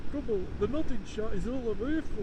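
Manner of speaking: imitated viewer. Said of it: "I know what you're thinking".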